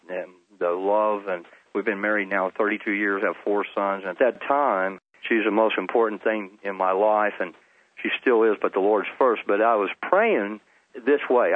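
ordinary delivery

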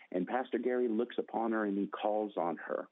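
normal talking voice

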